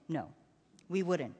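low, quiet father's promise; reassuring father. A narrator's reading. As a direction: reassure a child softly. Father reassures his son.

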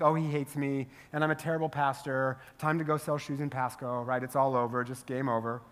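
low, quiet, resigned self-talk; fearful defeat. Acted. Pastor takes friend's advice through fear, defeated. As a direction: sink into mock despair, voice dropping. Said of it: "if I interpret that through fear".

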